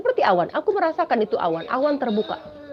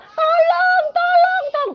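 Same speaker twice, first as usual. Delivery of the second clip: high panicked calls for help, much higher and louder; terrified cries; 4 dB louder, slower than usual. Retold or imitated. Crowd in the afterlife crying out in terror. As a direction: scream for help in a crush of people. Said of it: "semua orang berteriak".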